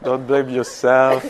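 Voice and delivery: giggling as the joke builds; laughing. Real, not acted. Teacher laughing at own joke to meditation group. Joking not to blame the author.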